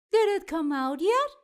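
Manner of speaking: high, loud and quick, bursting to know; eager question. A narrator's reading. Marty bursting in on Pete, eagerly asking. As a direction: ask it fast, can't wait any longer. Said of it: "he asks".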